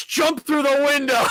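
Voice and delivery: high, loud, slow shouted escape cry; panicked yell. Retold or imitated. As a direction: scream it like fleeing the janitor. Acted panic escape in story.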